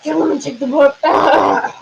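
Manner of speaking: lightly hammed-up high voice; mock villain order. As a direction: play at goading them to kill, then cry out.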